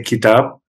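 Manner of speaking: "kitab" with an open, front "a"; light pronunciation. Shown as a demonstration. Teacher first giving Pashto word for book, light "kitab". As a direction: say "kitab" light, vowel unrounded. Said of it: "kitab is more of a light sounding".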